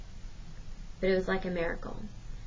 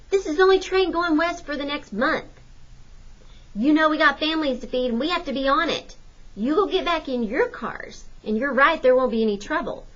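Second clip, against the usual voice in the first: raised, defiant worker; defiant man; 7 semitones higher, 4 dB louder than usual. A narrator's reading. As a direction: stand up to the cops. Man answering the cops.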